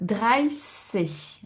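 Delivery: "dreißig" very slow, with a long s; pronounced like S. Shown as a demonstration. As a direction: draw out the s in "dreißig", no t. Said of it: "ça se prononce comme le S". (« dreißig » très lent, avec un s long)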